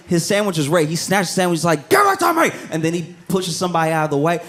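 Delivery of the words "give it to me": grab it with your voice; mock snatching yell. Man snatches his finished sandwich, high mock yell.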